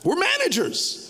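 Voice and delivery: rising preacher's call; preaching emphasis. Real, not acted. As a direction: proclaim it to the crowd.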